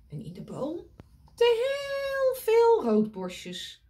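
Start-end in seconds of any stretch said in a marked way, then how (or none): 1.3-3.8 very high, slow birdy chirp; high chirping robins; tweeting robins. Acted. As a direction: squeak it like a flock of little birds.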